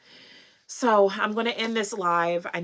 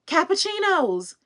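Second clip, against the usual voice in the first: calling out; 8 semitones higher than usual. Retold or imitated. Stranger calling after her in the parking lot, calling out. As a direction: call the nickname across the lot, rising. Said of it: "and I hear".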